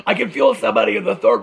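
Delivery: mock a chain-smoking TV psychic calling out; Sylvia Browne. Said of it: "smoked five packs a day".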